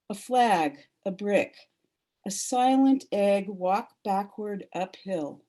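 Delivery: measured poetry reading voice; solemn reading. Acted. Reads poem aloud.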